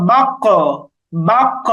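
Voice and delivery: "বাক্য" with a bounced, echoing q; bouncing qalqalah. Shown as a demonstration. Teacher shows learners how to sound qaf, bouncing qalqalah. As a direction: push the stopped q in "বাক্য" into a short echo. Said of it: "এরকম উচ্চারণ করতে হবে".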